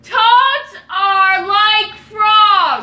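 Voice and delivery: loud, high, deliberate repeat; yelling. Real, not acted. Player repeating the phrase to a hard-of-hearing partner, yelling. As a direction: belt the phrase out to be heard. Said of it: "You yell so stinking loud".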